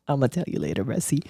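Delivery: whisper-tease it like a secret for later; hushed teasing aside. Real, not acted. Teasing aside about splurging.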